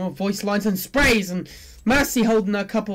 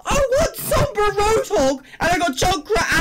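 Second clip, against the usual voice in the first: real exasperated shouting, much higher; frustrated yell; 10 semitones higher than usual. Real, not acted. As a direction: shout in despair at the game. Disappointing final legendary.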